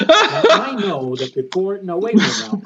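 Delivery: loud laugh-filled talk. Real, not acted. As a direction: talk through big laughter. Laughing while talking loud.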